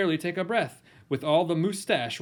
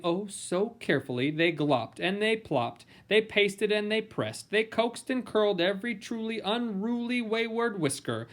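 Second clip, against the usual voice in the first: ordinary delivery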